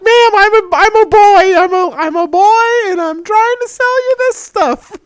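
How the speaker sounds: put-on teen boy with an unbroken voice insisting he's male; girl voice. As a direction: squeak out that you're a boy. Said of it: "So I had a girl voice".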